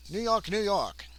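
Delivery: high-pitched put-on transatlantic voice; New Yorker with transatlantic airs; transatlantic accent. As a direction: play up an old-time transatlantic accent. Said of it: "He talks with a transatlantic accent".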